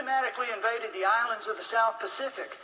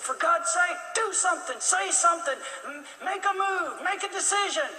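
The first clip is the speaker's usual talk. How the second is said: higher, pressing plea to act now; urgent plea; 8 semitones higher than usual. Retold or imitated. Brother begging Chamberlain to act, urgent higher pleading.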